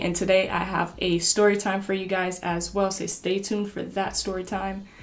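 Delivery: ordinary delivery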